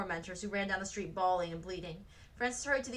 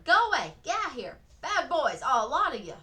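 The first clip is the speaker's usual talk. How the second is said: high, loud scolding from a window; yelling at children; 5 semitones higher, 9 dB louder than usual. A narrator's reading. Woman at the window yelling at kids, loud scolding. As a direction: shoo the kids away at full volume. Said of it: "yelled at a group of children".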